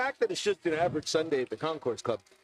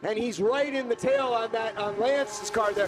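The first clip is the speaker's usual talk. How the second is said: motorsport commentator; 6 semitones higher than usual. Real, not acted. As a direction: narrate every move as it happens. Live race call.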